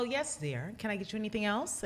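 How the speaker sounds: sweet, soft 1950s housewife voice; Betty Homemaker voice. Retold or imitated. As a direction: play the dutiful little homemaker. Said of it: "put on this little Betty Homemaker voice".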